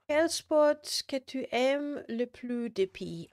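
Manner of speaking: slow, hammed-up French accent; terrible French accent. Streamer reads a viewer's question in a put-on French accent. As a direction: ham up a French accent, slowly. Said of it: "that was a terrible French accent".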